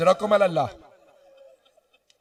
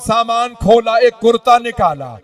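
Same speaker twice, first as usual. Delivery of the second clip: raised, high narration building into a cry; high, swelling majlis delivery; impassioned lament preaching; 6 semitones higher, 6 dB louder than usual. A narrator's reading. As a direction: build the tragedy toward a loud wail. Lamenting narration at rising force.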